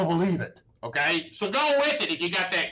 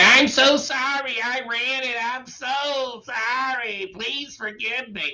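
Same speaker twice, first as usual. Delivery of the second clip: higher, slow pleading apology; mock groveling; 6 semitones higher, 4 dB louder, slower than usual. Lawyer mocking a driver begging the officer, higher and slow. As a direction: overdo the sorry like a begging driver. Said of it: "I don't wanna say".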